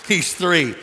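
usual speaking voice

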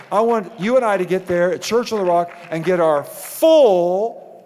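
loud, building sermon cry; a pastor rousing his church; preaching at full force. Acted. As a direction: preach it to the rafters. Preaching climax.

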